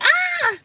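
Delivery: high, loud yelp as if burned; mock yelp of pain. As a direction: yelp like the feet are burning. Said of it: "I'm gonna be like".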